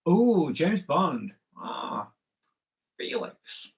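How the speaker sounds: drawn-out mock film-star impression, part hushed; hammed-up Bond actor, swooping high on the exclamation; playful, slowed-down celebrity impersonation; Sean Connery. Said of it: "That's my impression of Sean Connery".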